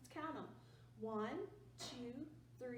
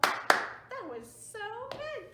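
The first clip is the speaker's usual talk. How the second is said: excited praise; 8 semitones higher, 13 dB louder, much slower than usual. Real, not acted. Praising kids after song.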